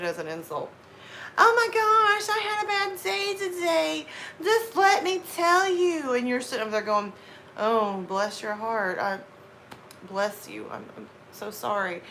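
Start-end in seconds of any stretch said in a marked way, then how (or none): 1.4-6.1 very high mock whining; whiny complainer. Friend venting about a bad day, mock whining. 7.6-12.0 sarcastic sympathy. Acted. Listener answering a complainer's bad day, sarcastic sympathy. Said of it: "well versed in sarcasm and insults".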